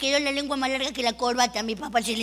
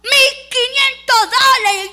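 high, loud, slow bid; shouted bid; 9 semitones higher, 9 dB louder, slower than usual. Retold or imitated. Second bidder shouts higher, loud. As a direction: yell the counteroffer. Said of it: "Y otro más gritó".

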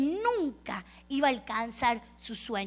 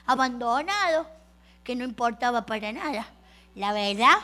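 normal talking voice

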